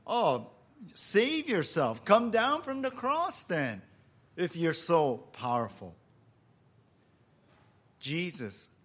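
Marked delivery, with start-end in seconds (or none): 0.1-6.0 raised, goading taunt; high-pitched retold challenge, falling off at the end; mocking jeer. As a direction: imitate a crowd sneering at their victim. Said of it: "they're mocking him".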